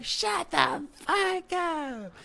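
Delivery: very high, drawn-out falsetto shout; stretched squeaky mock scream; mimicked crowd yell. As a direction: imitate half a room yelling in slow unison. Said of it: "this whole side with the microphone side was like".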